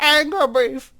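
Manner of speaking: high, loud mock Spanish accent; Mexican accent. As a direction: say it like the cashier with a thick accent. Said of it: "They were like".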